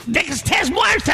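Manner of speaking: high, hammed-up English accent in Spanish. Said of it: "te falta hacerlo con acento en inglés". (acento inglés exagerado, agudo y gritado)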